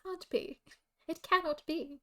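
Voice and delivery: hammed-up shocked posh mum. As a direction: gasp it out like a shocked posh mother. Posh mum reacting dramatically.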